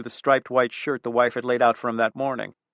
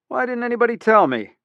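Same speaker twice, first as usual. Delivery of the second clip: much higher, a bit louder burst of relief; flooded with relief; 10 semitones higher, 5 dB louder than usual. A narrator's reading. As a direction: let the relief spill out. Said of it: "his voice was bombed with relief".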